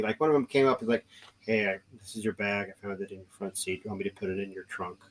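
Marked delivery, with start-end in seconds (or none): 1.4-5.0 soft, low, considerate cop voice; low-key, gentle offer of help; super nice officer. Retold or imitated. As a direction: imitate a kindly officer asking quietly. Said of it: "being super nice to me".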